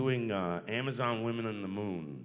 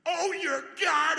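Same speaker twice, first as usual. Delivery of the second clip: much higher, louder, slow robot voice; robot exclamation; 10 dB louder than usual. Retold or imitated. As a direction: launch into the exclamation in character. Starts voicing his reaction in character.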